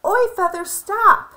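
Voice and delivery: high, loud call after it. A narrator's reading. Lolly shouts after her feather flying away, high and loud.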